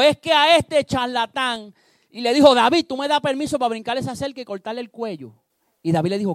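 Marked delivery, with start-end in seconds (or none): 0.0-1.7 high, loud and slow, spitting out the insult; a furious bodyguard ready to strike; angry outburst. Acted. Abisai to David about Simi: slow furious shout. 2.5-5.3 eager angry request. Acted. Abisai asks leave to kill.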